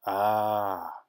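drawn-out, dark "ah" from the rat man. A narrator's reading. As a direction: sound darkly knowing. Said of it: "the rat man said darkly".

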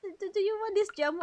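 ordinary delivery

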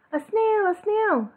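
high, shocked cry of alarm; a teacher spotting a snail in class; shocked shriek. Acted.

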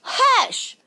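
high-pitched storybook shush; yelled hush. A narrator's reading. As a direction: call out crossly for silence as Gramps. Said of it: "And Gramps yelled".

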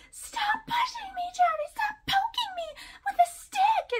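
very high, loud whiny fake-victim squeal; mock victim voice. Mocking the wife faking abuse on audio, very high squealing plea. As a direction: ham up a staged helpless cry for the recording. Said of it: "her voice changes and she's like".

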